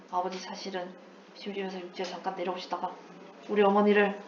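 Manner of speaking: 낮고 작은 목소리로 털어놓는 아들 흉내; 사연을 나직이 고백하듯; 조심스러운 고백 연기. Acted. (소리 죽여 털어놓는 아들 연기)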